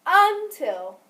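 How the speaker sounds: Until said high and loud on the first syllable UN; UN-til, the wrong stress on purpose. Shown as a demonstration. Teacher repeating first-syllable stress on Until for the student. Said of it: "syllables that are accented are gonna be louder".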